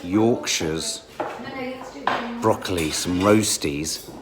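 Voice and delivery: low, slow, savouring voice; food commentary. Pretend. Showing off roast beef and Yorkshires, low slow savouring voice. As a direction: savour each dish slowly, pitched low and soft. Narrates the meal for camera.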